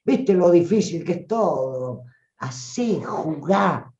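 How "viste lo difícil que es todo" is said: hammed-up self-pitying lament, sighing off. Shown as a demonstration. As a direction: moan about how hard life is. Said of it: "Habla lamentándote".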